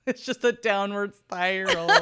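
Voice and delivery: laughing through the words, squeaky laugh at the end; can't stop cracking up mid-read; real giggles breaking the sentence; giggling fit. Real, not acted. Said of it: "this was fun while it lasted".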